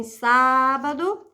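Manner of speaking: "sábado" with a long, strong "sá"; stress on antepenultimate syllable. Shown as a demonstration. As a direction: hold the first syllable of "sábado". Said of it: "O mesmo acontece em". ("sábado" com o "sá" forte e alongado)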